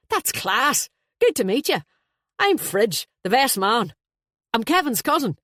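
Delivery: high, loud, friendly introduction; hearty best man. Acted. Best man introducing himself to wedding guests, hearty. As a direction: greet them with big enthusiasm, pitch up.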